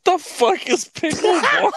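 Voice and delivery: high, loud, drawn-out burst of laughing bafflement; laughing disbelief. Real, not acted. Brother hears of pickleball, laughing in disbelief. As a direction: blurt it out, breaking into laughter.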